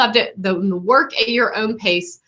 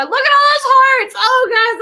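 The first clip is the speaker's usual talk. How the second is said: much higher and louder, thrilled to bits; delighted squeal; 6 dB louder than usual. Real, not acted. Teacher squealing at her students' heart reactions, overjoyed. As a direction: squeal with joy at all the hearts.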